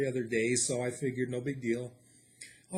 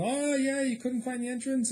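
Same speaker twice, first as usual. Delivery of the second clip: high, mock-innocent teasing question; joking jab; 12 semitones higher than usual. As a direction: needle them about the entrance in a joking way. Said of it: "I'm kinda joking".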